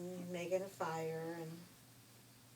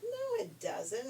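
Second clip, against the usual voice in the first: much higher, slow indignant no; high scolding; 5 dB louder, slower than usual. Acted. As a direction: squeal it in outrage. Messenger refuses.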